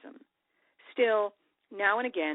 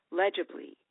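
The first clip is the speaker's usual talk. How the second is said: ordinary delivery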